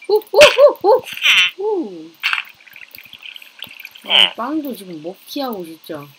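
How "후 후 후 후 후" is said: heavy puffing. Real, not acted. Blowing or panting hard.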